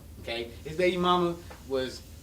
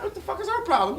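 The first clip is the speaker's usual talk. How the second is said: high, laughing disbelief; baffled outrage; 13 semitones higher, 3 dB louder than usual. Retold or imitated. To himself about Jessica, laughing disbelief. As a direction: ask it stunned and laughing.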